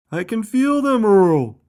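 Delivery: very high, loud answering shout; full-voiced high yell. A narrator's reading. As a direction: yell back through the waves. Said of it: "shouted Buddy".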